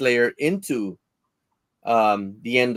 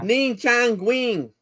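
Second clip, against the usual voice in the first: high, slow mock Japanese; 10 semitones higher, much slower than usual. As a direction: drag out fake Japanese words.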